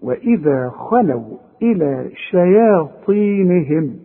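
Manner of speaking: وإذا خلوا إلى شياطينهم recited slowly, every letter given its due; careful tajweed reading; slow Quran recitation. Shown as a demonstration. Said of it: "تدي كل حرف حقه".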